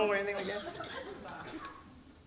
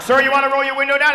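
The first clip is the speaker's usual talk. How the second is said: stern cop; 5 semitones higher, 8 dB louder than usual. Retold or imitated. Cop on horse at window.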